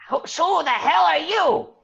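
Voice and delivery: high, loud monster shriek; affronted magmin. Acted. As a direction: screech the challenge at the intruder. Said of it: "you just see the, the magmen".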